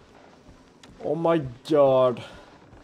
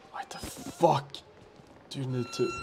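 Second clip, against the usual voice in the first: scared whisper; 4 semitones lower, 10 dB quieter than usual. Real, not acted. Whispering while scared in game.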